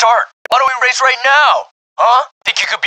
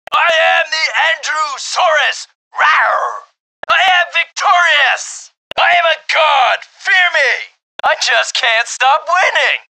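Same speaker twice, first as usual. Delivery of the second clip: gloating shout; 6 semitones higher than usual. Acted. Winning racer gloating over beaten rival, roaring. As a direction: crow like a cartoon dinosaur king. Winner gloats after race.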